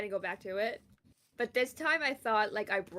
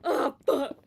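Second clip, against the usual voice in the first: startled; 4 semitones higher, 7 dB louder than usual. Real, not acted. Sudden game attack.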